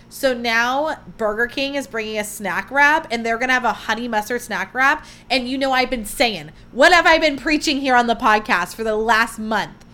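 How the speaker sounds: ordinary talk, nothing marked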